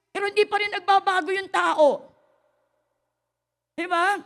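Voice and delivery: loud, high preaching; exasperated loud appeal. Acted. Pastor lamenting that people don't change, loud and high.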